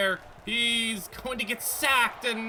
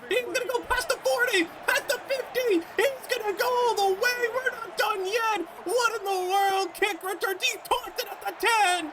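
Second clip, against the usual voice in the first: building excitement; 11 semitones higher than usual. Real, not acted. Gamer calls his kick return, soaring high and excited. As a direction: voice soaring high, calling the run.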